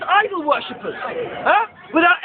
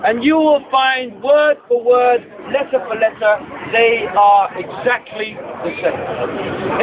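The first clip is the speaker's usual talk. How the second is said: slow emphatic; 4 semitones lower, slower than usual. Real, not acted. Emphatic climax of proof claim.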